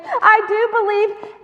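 high, loud cry; fervent shout; exclamation. Acted. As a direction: blurt it out at once.

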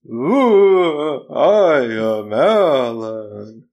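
slow, drawn-out ghostly moan; haunt them from beyond the grave; spooky ghost voice. Acted. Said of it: "I'm just a spooky E V P recording".

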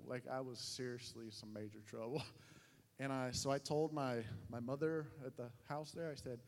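normal talking voice

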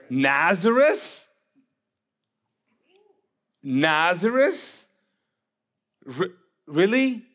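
drawn-out, rising incredulous question; skeptical disbelief. Acted. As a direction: stretch it slowly as if you can't believe it. Acting Nathanael's skeptical first response.

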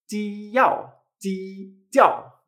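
"jiao" drawn out from a held "ji" into "ao"; unaspirated "jiao", stretched to show the J; broken-down Chinese J. Shown as a demonstration. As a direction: take "jiao" apart slowly, ji-ao. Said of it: "tenemos que pronunciarlo con esta J".

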